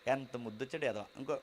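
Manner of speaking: soft, low mock doting; a lightly voiced cruel aunt cooing over a crying baby; cruel cooing taunt. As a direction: coo it while meaning harm. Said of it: "అని రెండు పడేస్తారు".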